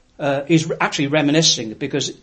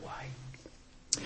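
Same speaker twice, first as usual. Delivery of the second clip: drops to a much lower, softer whisper; 22 dB quieter than usual. Acted. Preacher ends the text in an awed whisper. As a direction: hush it in awe.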